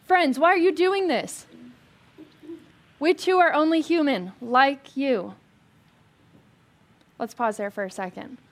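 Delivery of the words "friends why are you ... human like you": a mildly raised, higher shout to the crowd. A narrator's reading. Apostles shouting to the crowd to stop sacrifices, raised voice.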